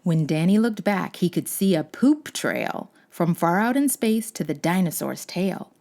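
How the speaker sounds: ordinary delivery, nothing marked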